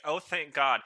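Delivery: loud, relieved outburst at a talking animal; relieved surprise. Retold or imitated. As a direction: blurt it out, half thanks half shock. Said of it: "I'd be like".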